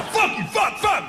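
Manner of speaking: high, sharp bursts of swearing; angry cusser. Acted. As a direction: imitate an angry cusser, spat out word by word. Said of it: "I'm not one of them "fuck you" cussers like that".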